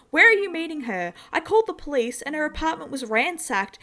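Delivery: high, loud, panicked pleading; a terrified mother demanding answers; frantic mother. A narrator's reading.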